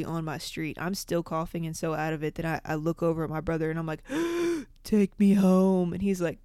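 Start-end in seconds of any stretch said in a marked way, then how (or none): 4.1-4.6 a high, sudden gasp; sharp mock gasp. Panicking high in the car. 4.8-5.9 slow, loud "Take me home"; stoned kid begging his brother, slow and loud; desperate mock plea. Panicking high, begging brother.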